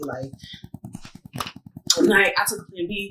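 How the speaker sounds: normal talking voice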